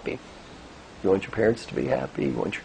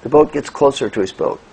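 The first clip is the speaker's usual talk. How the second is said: ordinary delivery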